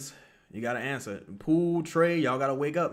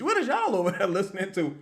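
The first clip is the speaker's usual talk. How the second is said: ordinary delivery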